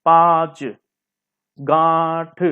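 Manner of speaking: पाँच and गांठ with long held nasal vowels; slow repeat-after-me model of पाँच, गांठ; nasalised vowel demonstration. Shown as a demonstration. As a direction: draw out पाँच, गांठ through nose and throat. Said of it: "बोल के देखिए आप लोग".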